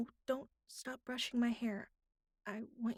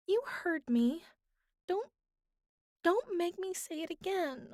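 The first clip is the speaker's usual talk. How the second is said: higher and louder, flustered and half-whining; embarrassed insistence; 7 semitones higher, 6 dB louder than usual. Acted. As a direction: push back, too shy to repeat it. Embarrassed at repeating the request.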